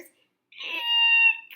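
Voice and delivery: put-on very high, loud girl's scream; screamed eek. A narrator's reading. Goldilocks waking to see the bears, high loud scream. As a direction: scream it in sudden fright. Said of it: "screamed Goldilocks".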